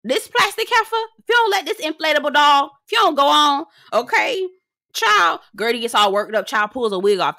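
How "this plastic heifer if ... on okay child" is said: high sassy Russell glare voice. Retold or imitated. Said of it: "he was looking at her like".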